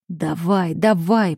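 ordinary delivery, nothing marked

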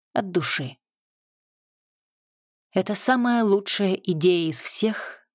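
ordinary delivery

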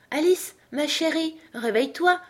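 coaxing. A narrator's reading. Sister wakes Alice on her lap, coaxing.

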